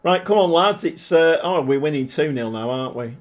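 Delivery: ordinary talk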